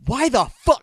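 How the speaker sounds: high, loud exasperated shout; retold yell. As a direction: yell it at a maddening coworker. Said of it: "I just yelled".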